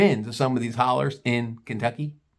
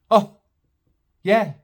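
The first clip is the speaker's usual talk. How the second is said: usual speaking voice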